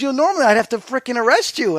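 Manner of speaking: half-joking warning from the officer; voicing the cop.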